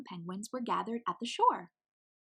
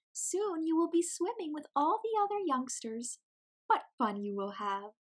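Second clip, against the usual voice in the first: encouraging mama penguin; 8 semitones higher than usual. Acted.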